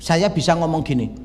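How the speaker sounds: ordinary delivery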